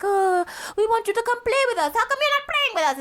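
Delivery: high-pitched imitation of whiny children; pleading kids. Aunt imitates kids asking her to play, high whine. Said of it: "and they were like".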